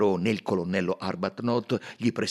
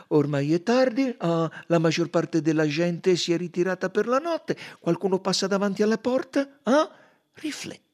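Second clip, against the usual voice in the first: Poirot prompting; 12 semitones higher, 9 dB louder than usual. Acted. Poirot builds the scene.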